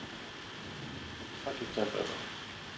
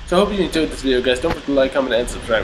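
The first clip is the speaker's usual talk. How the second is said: louder upbeat youtuber sign-off; outro sign-off; 3 semitones higher, 8 dB louder, faster than usual. Acted. Youtuber closing his video to viewers, upbeat sign-off.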